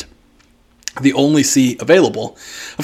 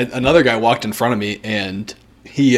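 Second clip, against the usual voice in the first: ordinary talk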